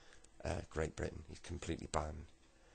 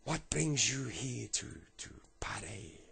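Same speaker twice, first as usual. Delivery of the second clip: put-on slow French accent, higher then breathed away; rough French accent; 5 semitones higher, slower than usual. Homeless man questions the lad, rough French accent. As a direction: ask slowly as a put-on Frenchman. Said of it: "I can't do a brilliant French accent".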